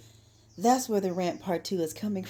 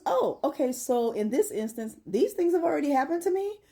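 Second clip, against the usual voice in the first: mock surprise; 8 semitones higher than usual. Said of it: "I says".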